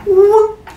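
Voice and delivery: high, loud vanishing sound; playful invisibility whoop. Acted. Goddess turning herself invisible, high playful whoop.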